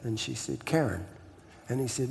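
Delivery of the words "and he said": ordinary talk, nothing marked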